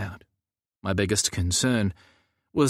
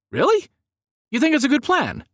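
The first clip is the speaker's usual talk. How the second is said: much higher, louder incredulous questions; stunned disbelief; 5 dB louder than usual. Acted. Narrator stunned Samuel approves the plan, much higher and louder. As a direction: shoot the pitch up in disbelief.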